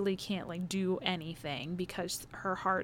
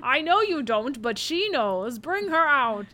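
loud megaphone shout, higher; through a megaphone; 6 semitones higher, 7 dB louder than usual. Retold or imitated. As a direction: bellow it through a bullhorn. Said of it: "shouting into a megaphone".

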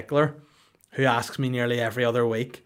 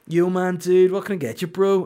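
lightly raised, easygoing dude voice; a hint of a laid-back server greeting; mock bro voice at the counter; casual bro server; 7 semitones higher, 4 dB louder than usual.

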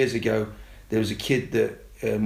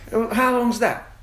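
put-on much higher, insistent child voice; nagging little kid who won't let it go; high kid voice, mock exasperated; pestering kids; 11 semitones higher, 3 dB louder than usual.

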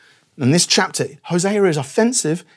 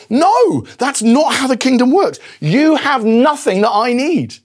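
emphatic preaching; 7 semitones higher, 3 dB louder, slower than usual. Acted. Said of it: "And Jesus Christ says".

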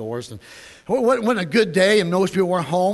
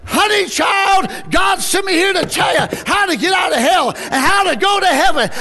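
high, loud preaching cry; impassioned shout; 13 semitones higher, 7 dB louder than usual. Retold or imitated. Soul winner telling the distraught lady of heaven, impassioned shout. Answering her outburst at full force.

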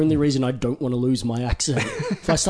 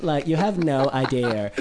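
put-on slow Valley Girl accent; slower than usual. Shown as a demonstration. Showing her one American voice, slow Valley Girl accent. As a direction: stretch it out in Valley Girl speak. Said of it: "the, the Valley Girl".

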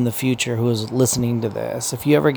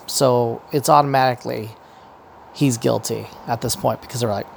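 ordinary talk

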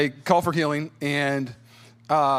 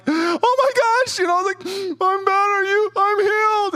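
much higher, loud, tearful cries of joy; weeping joy; 6 dB louder than usual. Retold or imitated. Healed man to the one praying, weeping much higher. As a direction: cry out the healing through tears. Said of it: "just absolutely weeping".

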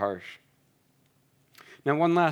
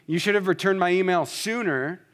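blunt and accusing, a little raised; 7 semitones higher, 3 dB louder than usual. Shown as a demonstration. Pastor demonstrating an accusing complaint, blunt. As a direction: say it like a reproach. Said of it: "Instead of saying".

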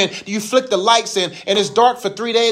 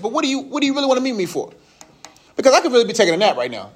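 rushed mock impatience; 3 semitones higher, faster than usual. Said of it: "I don't say it like that".

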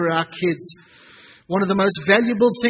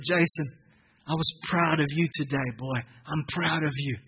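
softer, tender praise; 4 semitones lower, 5 dB quieter than usual. Retold or imitated. Father telling son he is proud after the race, soft and tender. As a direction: say it quietly, meaning every word.

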